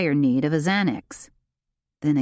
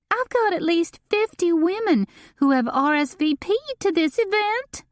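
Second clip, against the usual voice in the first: much higher, shrill frantic complaint; shrill it in a panic; high, piercing, frantic voice; 14 semitones higher than usual. A narrator's reading. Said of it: "Delane shrilled".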